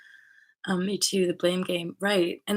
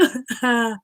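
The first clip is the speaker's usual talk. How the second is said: light laugh; 5 semitones higher, 4 dB louder than usual. Real, not acted. Laughing after story.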